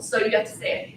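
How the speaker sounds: ordinary delivery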